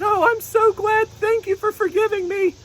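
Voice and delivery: little unicorn begging forgiveness, near tears; sorry unicorn puppet. Pretend. Unicorn puppet apologizing to the pastor, very high and pleading. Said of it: "she said".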